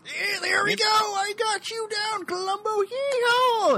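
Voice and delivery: cowboy voice, slow and high; yeehaw. Acted. Acting out the cowboy lawyer.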